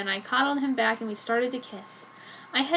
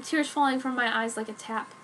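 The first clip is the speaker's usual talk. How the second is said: plain reading voice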